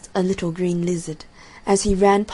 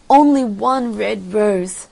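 slow, loud plea; pleading cry; 2 semitones higher, 6 dB louder, slower than usual. A narrator's reading. Nightingale begs the tree, slow insistent cry. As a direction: draw out the entreaty, loudly. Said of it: "cried the nightingale".

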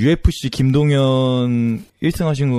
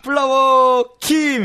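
fight announcer intro; 12 semitones higher, much slower than usual. Shown as a demonstration. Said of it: "홍코너 막 김동현 막 이런 거 소개하듯이".

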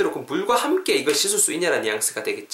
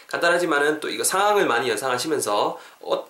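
ordinary talk

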